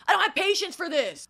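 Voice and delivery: loud, high imitation of an angry scream; retold screaming. Retelling Mick storming away from the hug, screamed. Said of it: "he walks away screaming".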